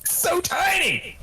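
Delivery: high, slow, drawn-out exasperated shout; exasperated cry. Real, not acted. Coder groaning how tiny the bug was, high and drawn out. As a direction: stretch it out in disbelief.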